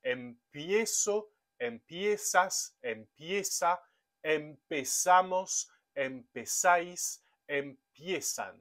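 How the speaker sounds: each form of "empiezo" through "empiezan" said slowly and carefully; slow conjugation drill. Shown as a demonstration. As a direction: dictate the forms slowly to the class. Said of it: "Le verbe empezar va se conjuguer de cette manière".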